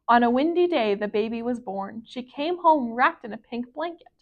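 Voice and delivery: plain reading voice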